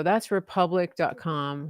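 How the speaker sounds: ordinary delivery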